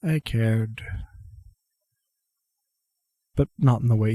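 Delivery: subdued confession in short pieces; resigned old man. Acted. Grandfather confesses he cared, subdued resignation. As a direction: admit it quietly, long pauses between thoughts.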